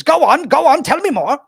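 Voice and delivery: very high, loud wizard squeal; eager old wizard. Acted. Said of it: "He goes".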